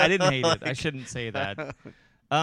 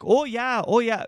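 high, loud mock Wisconsin accent; exaggerated, high-pitched Upper Midwest accent; hardcore Midwestern accent; 10 semitones higher, 8 dB louder than usual. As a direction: imitate a drunk friend gone full Minnesotan. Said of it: "like, a hardcore Midwestern".

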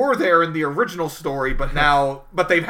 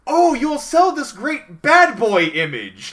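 loud, high-pitched mock enthusiasm; hammed-up slow, high sales gushing; gushing corporate honcho; 9 semitones higher, 8 dB louder, slower than usual. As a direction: play an over-keen executive spinning it positive. Mocking corporate honchos spinning everything positive.

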